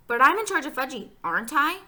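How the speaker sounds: loud, high insistence; bossy girl. A narrator's reading. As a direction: stake a claim like a pushy kid. Bossy girl demands to be in charge.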